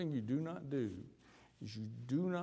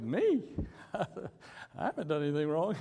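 high, loud startled "Me?" with a laugh; 5 semitones higher, 4 dB louder than usual. Retold or imitated. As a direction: protest innocence half laughing.

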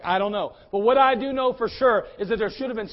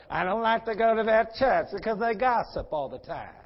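mocking put-on complainer's voice; mock complaining churchgoer. Preacher mimicking people who complain about church gossip, mock complaint. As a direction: imitate a whiny complainer, voice put on. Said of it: "tired of hearing people talk about".

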